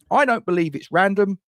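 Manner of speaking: normal talking voice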